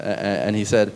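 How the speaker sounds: ordinary talk, nothing marked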